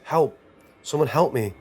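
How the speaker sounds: high, loud desperate scream; screaming for help. A narrator's reading. Lost in a burning hellscape, screaming for help. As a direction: scream for help with everything you have. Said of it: "I screamed as loud as I possibly could".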